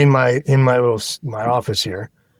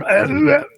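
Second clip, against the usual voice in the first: high strained dying gasps; death rattle; 13 semitones higher than usual. Shown as a demonstration. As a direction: choke out short dying grunts. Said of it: "somebody going".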